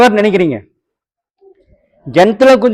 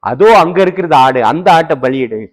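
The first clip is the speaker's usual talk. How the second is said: voice from heaven; 11 semitones lower than usual. Retold or imitated. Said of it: "அப்பொழுது சத்தம் வருகிறது". (தாழ்ந்த மென்மையான தெய்வக் குரல்)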